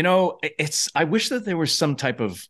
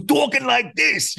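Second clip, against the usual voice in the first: mock New York guy voice; New York accent; 13 semitones higher, slower than usual. Shown as a demonstration. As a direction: slip into a thick New York accent mid-sentence. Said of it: "like a really heavy, heavy New York accent".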